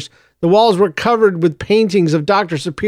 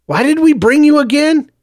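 high, louder and fed up; exasperated; 9 semitones higher, 4 dB louder than usual. A narrator's reading. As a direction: scold him with disbelief. Joseph scolds Clovis.

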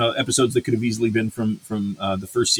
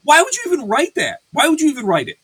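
agitated rant; 8 semitones higher than usual. Real, not acted. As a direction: vent real irritation at the writers. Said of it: "I'm agitated right now".

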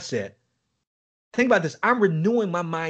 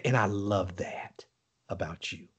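whispered voice of God; 8 semitones lower, 7 dB quieter than usual. Retold or imitated. The Holy Spirit telling him, whispered. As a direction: let it sink to a whisper. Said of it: "He said".

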